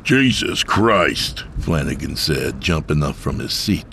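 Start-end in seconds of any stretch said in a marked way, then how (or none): 0.0-1.4 drawn-out, higher exclamation; slow, raised-pitch cry of shock; startled oath. A narrator's reading. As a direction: blurt it as if startled from behind. Driver startled by voice behind him.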